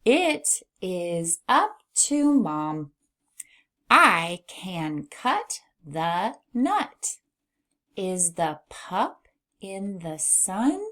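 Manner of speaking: slow, measured read-aloud in whole sentences; smooth practice reading. Shown as a demonstration. Teacher reviews practiced sentences, reading slowly and smoothly. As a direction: read slowly and connectedly for beginners. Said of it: "try to read it smoothly".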